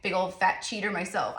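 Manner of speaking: usual speaking voice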